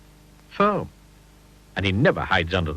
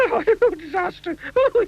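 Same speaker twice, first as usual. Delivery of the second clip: squeaky panicked shriek, much higher; terrified scream; 3 dB louder than usual. A narrator's reading. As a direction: shriek in terror. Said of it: "he screamed".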